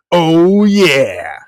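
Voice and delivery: normal talking voice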